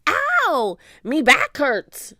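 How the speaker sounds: high, loud yelp into complaint; pained cry. A narrator's reading. Stinky complaining of a hurt back, crying out. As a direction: cry out in pain. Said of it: "Stinky cried".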